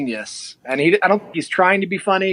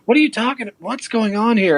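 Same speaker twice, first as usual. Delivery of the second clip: higher, baffled questions; incredulous; 6 semitones higher than usual. Retold or imitated. Asking the beard club president what is happening, higher and incredulous. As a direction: push the pitch up in disbelief. Retold shocked reaction continues.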